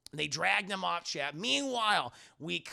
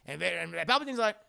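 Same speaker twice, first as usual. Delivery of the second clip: ordinary talk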